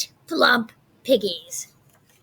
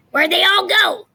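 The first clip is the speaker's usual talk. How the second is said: a higher, louder call across the farm; witch's shout; 7 semitones higher, 6 dB louder than usual. Acted. Gritch shouting to a duck, loud and high. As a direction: yell it out to the duck. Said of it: "Gritch shouted to a duck".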